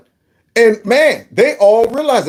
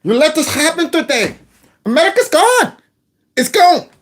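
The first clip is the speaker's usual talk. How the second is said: higher, mock-dramatic echo of her words; imitating the woman; 7 semitones higher than usual. Host echoes a protester's warning in a light mock voice. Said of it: "You hear that, woman?".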